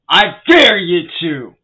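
slow, loud, menacing challenge; loud dare. Real, not acted. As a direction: dare them, slowly and loud. Threatening trolls at rant's end.

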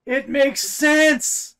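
loud, high, stretched-out cry; mock outburst. Co-host heckling the retelling of Gandalf's return, mock outburst. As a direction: belt it out slowly in mock indignation.

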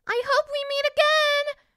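very high, loud princess call. A narrator's reading. Princess calls after the departing jay, high and loud. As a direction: call up to someone flying away. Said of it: "called the princess".

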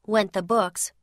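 ordinary delivery, nothing marked

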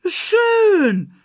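very high, loud, stretched screech. A narrator's reading. Timon reacts to his friends leaving, screeching. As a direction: shriek it out in a huff. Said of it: "kreischte Timon".

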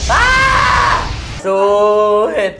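shout. Real, not acted. Struggling eating with hands.

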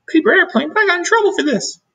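very high, fast, loud delight; teacher thrilled to find a paper airplane, very high and fast; excited gasp. A narrator's reading. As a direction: squeal with sudden excitement.

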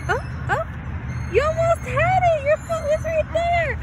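high, loud cheering parent voice; encouraging mom. Real, not acted. Mom coaching daughter climbing at park.

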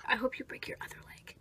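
fast, soft whispered jab; spiteful whisper. Acted. Bill leaving the dentist, spiteful fast whisper. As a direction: whisper it under your breath.

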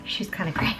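breathy whispered aside. Real, not acted. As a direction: whisper it like a secret. Whispered aside then repeated aloud.

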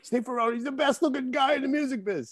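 high-pitched voice imitating a friend; T-Bone. Quoting a friend on a drummer's looks, high-pitched impression. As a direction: put on a high voice for the quote. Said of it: "My friend T-Bone, yeah".

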